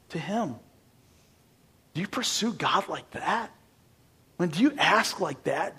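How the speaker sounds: normal talking voice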